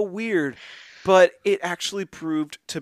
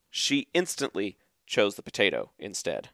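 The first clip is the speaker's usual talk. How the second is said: usual speaking voice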